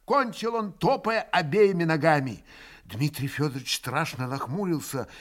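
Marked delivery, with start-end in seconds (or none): none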